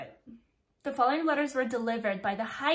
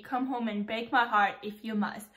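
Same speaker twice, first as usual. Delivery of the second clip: normal talking voice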